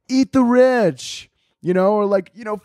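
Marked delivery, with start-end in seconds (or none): 0.1-1.3 slow, drawn-out, higher chant; mocking slogan. Mocking activists chanting a slogan, slow sneer. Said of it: "people are always like".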